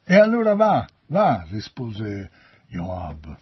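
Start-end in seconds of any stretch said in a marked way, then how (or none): 0.0-1.4 loud, high, impatient dismissal; exasperated consent. A narrator's reading. Joab finally lets Ahimaaz run off, exasperated. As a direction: give in and send them off loudly.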